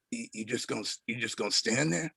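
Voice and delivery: low, hushed impression of a man's voice. Retelling a musician greeting his frozen young visitor, in a low hushed impression. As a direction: drop low and very soft, retelling his words. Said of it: "And he said".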